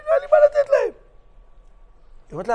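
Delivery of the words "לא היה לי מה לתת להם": high mock woman's voice. Rabbi's wife answers.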